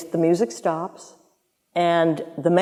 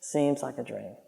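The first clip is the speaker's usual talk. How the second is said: much softer, lower, to herself; soft echo; 7 semitones lower, 12 dB quieter than usual. Real, not acted. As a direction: repeat "Seems like a dream." as a dreamy murmur. Echoing it to herself.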